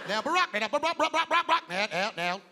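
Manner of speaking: record-scratch stutter on repeated syllables. Retold or imitated. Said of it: "Are you the president or a D J".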